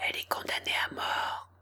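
soft secret whisper. A narrator's reading. Rabbit whispering the secret to Alice. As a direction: whisper it close into an ear. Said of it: "et lui souffla".

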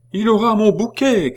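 high, loud excited woman; a woman in the crowd cheering the cardinal; crying out. Acted. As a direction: shout it joyfully to the street. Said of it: "cria cette femme".

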